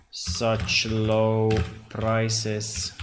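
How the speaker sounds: very slow, words drawn out; slow dictation. Real, not acted. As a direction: drag it out while typing. Slow while typing.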